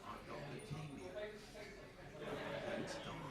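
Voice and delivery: put-on soft, breathy-whispered child; sick child. Sick boy telling the presenter, soft and whispered. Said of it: "I said, What's wrong with you".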